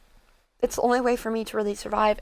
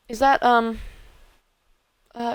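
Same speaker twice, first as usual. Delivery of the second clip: usual speaking voice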